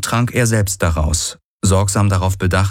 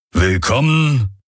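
Hox's slow voice; 5 semitones higher, slower than usual. Acted. Hox greets them grandly.

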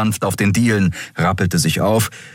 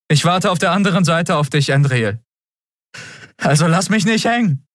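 tearful farewell; 10 semitones higher than usual. A narrator's reading. Tearful farewell called across a gap.

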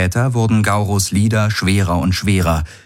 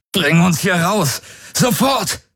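very high-pitched desperate command; strained, high emergency shout; urgent order; 12 semitones higher, slower than usual. A narrator's reading. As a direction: cry out for immediate escape. Galen orders escape amid the alarm.

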